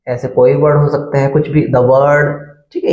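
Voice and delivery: ordinary delivery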